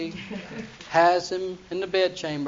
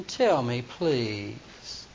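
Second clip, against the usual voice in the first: coaxing Delilah; 6 semitones lower, 5 dB quieter, much slower than usual. Retold or imitated. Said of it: "Delilah said to Samson".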